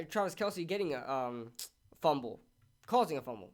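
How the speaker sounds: usual speaking voice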